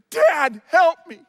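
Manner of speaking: loud, high cry for help; desperate plea. Acted. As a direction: call out urgently to a father to be rescued. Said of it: "He's desperate".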